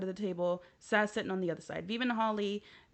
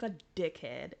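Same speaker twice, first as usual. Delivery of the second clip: normal talking voice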